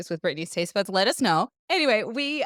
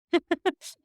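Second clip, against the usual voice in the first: short laugh; 10 semitones higher, 3 dB louder than usual. Real, not acted. Laughing at eating too many.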